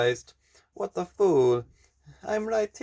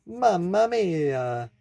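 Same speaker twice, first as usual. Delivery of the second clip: put-on Italian accent; 10 dB quieter, slower than usual. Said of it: "speaking Indonesian in an Italian accent".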